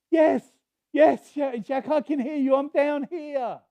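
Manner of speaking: high, loud calling up from below; frantic reply from the cliff; desperate answer. Retold or imitated. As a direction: shout up to the voice in relief. Jack shouts back from cliff.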